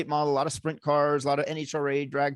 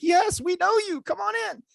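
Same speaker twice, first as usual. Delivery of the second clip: eager hotel clerk. Retold or imitated. Hotel staff voiced eagerly.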